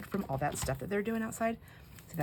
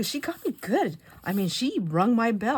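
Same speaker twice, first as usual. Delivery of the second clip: amused exclaim; 6 semitones higher, 7 dB louder than usual. Retold or imitated. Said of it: "I go".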